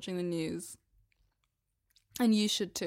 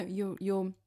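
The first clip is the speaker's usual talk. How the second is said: normal talking voice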